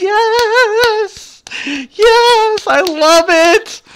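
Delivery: high-pitched, drawn-out squeal of delight; gleeful excitement. Real, not acted. Streamer gushing to the chat, gleeful and high. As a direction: squeal it, stretching the words.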